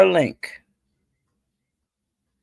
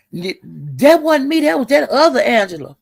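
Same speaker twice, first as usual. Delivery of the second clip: higher mock voice, denying it; mocking denial; 8 semitones higher than usual. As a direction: play the innocent other Angela, up high. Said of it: "you were saying".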